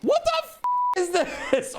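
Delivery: very high stunned squeal; shocked shriek. Real, not acted. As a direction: yelp it out in disbelief. Another huge donation appears.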